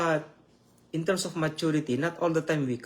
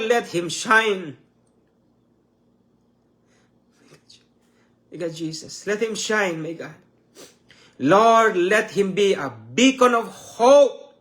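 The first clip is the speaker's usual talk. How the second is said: raised, drawn-out pleas with hushed invocations between; fervent prayer; 4 semitones higher, 3 dB louder, slower than usual. Real, not acted. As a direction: cry out to God for this man, stretching the key words. Prays aloud for an unknown man.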